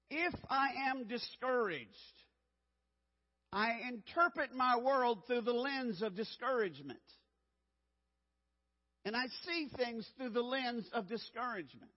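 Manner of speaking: emphatic sermon delivery; earnest preaching. Acted. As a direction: press the point home from the pulpit. Preaching point to congregation.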